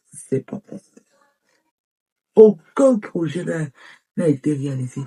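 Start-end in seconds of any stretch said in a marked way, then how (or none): 0.1-0.6 a hopeless whisper; whispered despair. Acted. Woman despairing over her failed year, whispered. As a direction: let it fall to a whisper of giving up. Despairing after listing failures.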